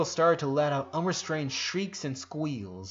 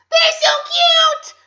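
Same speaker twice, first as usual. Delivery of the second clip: high, loud, slow squealing; 15 dB louder, slower than usual. A narrator's reading. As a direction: squeal with delight. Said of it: "squealed Lenny".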